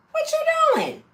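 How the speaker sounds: high, alarmed what-are-you-doing; shocked outcry. Retold or imitated. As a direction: yelp it in disbelief.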